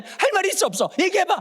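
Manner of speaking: mock stern mother asking what you have to say; scolding mom. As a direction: play the mom demanding an answer. Scolding mother voiced in story.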